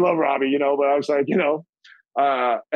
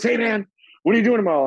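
higher, quick casual call; breezy friend on the phone; imitating Frankie; 7 semitones higher, faster than usual. Frankie calling him up, quick and higher. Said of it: "He goes".

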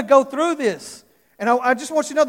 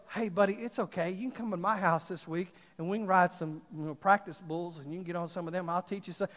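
softer, gentle calming voice; reassuring bull rider; 5 semitones lower, 11 dB quieter than usual. Retold or imitated. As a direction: comfort the scared boy kindly. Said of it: "he goes".

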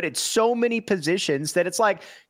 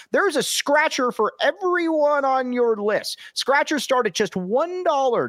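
booming prize announcer; 4 semitones higher than usual. Acted. Sponsor ad read.

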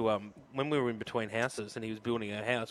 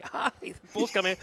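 mock shout, pitched up but held back; retold yell; 8 semitones higher than usual. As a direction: imitate teammates yelling a warning. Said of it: "they started yelling".

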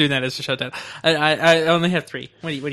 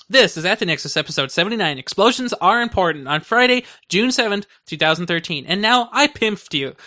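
show intro announcer; 4 semitones higher than usual. Acted. Records show title intro.

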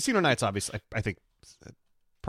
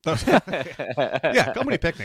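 high, loud, through laughter; real laughing agreement; 6 semitones higher, 6 dB louder, slower than usual. Real, not acted. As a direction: crack up while cheering the choice. Cracking up at co-host's correction.

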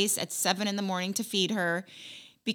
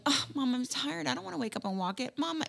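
high, soft tired whine; whiny tired kid; 5 semitones higher, 6 dB quieter than usual. Retold or imitated. Daughter complaining to mom about walking the dog, whiny. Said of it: "everybody's like".

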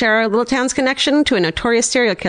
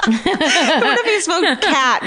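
laughing; 10 semitones higher, much slower than usual. Real, not acted. Imagining the cat talking.

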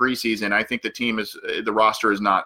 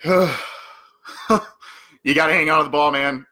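exasperated plea; 5 semitones higher than usual. Pretend. Host scolding a player who dropped the punt, exasperated. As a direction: plead with him after a heavy sigh.

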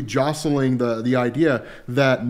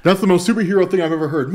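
usual speaking voice

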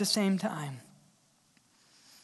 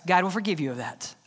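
normal talking voice

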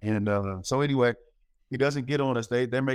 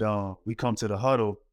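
ordinary talk, nothing marked

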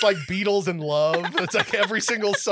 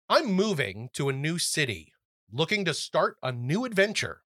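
softer, careful letter read; 2 semitones lower, 4 dB quieter than usual. Acted. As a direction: read the listener's letter gently. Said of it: "Millie writes us".

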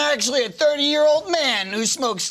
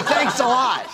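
ordinary talk

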